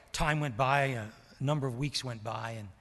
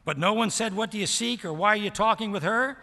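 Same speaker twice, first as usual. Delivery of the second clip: loud, driving pulpit voice; full-force preaching; 7 semitones higher, 9 dB louder than usual. Acted. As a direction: preach it out to the whole church.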